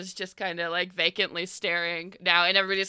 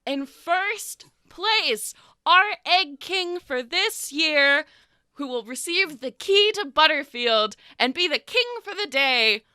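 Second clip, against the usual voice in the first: high, slow, grand announcer voice; high ceremonial host voice, slow; Lila crowning the winner; 9 semitones higher than usual. Acted. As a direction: draw out the big reveal, high and slow. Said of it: "she's like".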